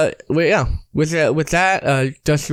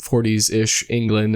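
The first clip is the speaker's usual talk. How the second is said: normal talking voice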